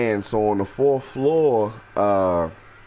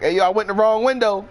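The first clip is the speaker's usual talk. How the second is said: fast, louder and much higher; real quick; 12 semitones higher, 4 dB louder, faster than usual. Retold or imitated. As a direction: blurt it fast and high. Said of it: "I say that shit real quick".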